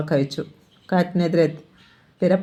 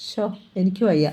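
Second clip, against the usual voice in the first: deflating breathy sigh; 2 semitones higher than usual. A narrator's reading. Rosa, overcome, sighing to the young man breathily. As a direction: air going out of her voice like a balloon. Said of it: "റോസയുടെ ശബ്ദം കാറ്റൊഴിച്ചു വിടുന്ന balloon പോലെയായി".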